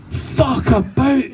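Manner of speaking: high, drawn-out exclamation; comic startled outburst; startled shout. Retold or imitated. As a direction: exclaim it slowly in comic shock. Startled reaction to noise, retold.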